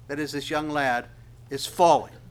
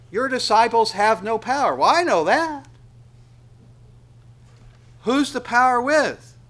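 much higher and louder, driving the point home; preaching at full force; 9 semitones higher, 9 dB louder than usual. Acted. Preacher pressing the congregation, higher and louder. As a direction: preach it out loud to the whole room.

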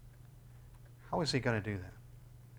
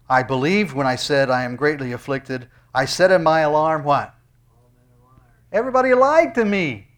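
full-force preaching; 2 semitones higher, 11 dB louder than usual. Acted. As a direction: thunder the verse out to the hall. Psalm quote preached loudly.

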